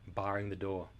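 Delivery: usual speaking voice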